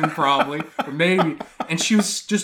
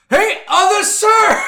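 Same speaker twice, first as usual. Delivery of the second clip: loud, much higher mock cashier call, slow; hammed-up shop clerk hailing a customer; imitating a cashier calling to another customer; 8 dB louder, slower than usual.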